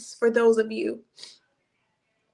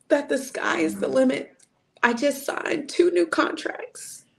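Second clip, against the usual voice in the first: higher, voice cracking with tears of gratitude; fighting back tears, grateful; tearful, voice breaking; 5 semitones higher than usual. Real, not acted. Telling viewers what God restored, tearful and breaking. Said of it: "I'm trying not to get emotional".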